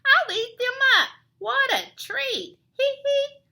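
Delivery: very high, loud cackling bat voice; shrieky villain bat with high tittering; squealing bat. A narrator's reading. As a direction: squeal it like a gloating cartoon bat.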